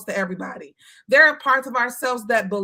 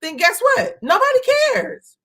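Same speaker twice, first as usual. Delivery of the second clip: high, loud "nobody cares"; emphatic; 13 semitones higher, 5 dB louder than usual. Real, not acted. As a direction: land it with a big emphatic swing. Emphatic rhetorical punchline.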